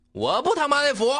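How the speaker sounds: high, loud angry defiance; defiant shout. Acted. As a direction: throw it back in his face. Defiant answer in quarrel.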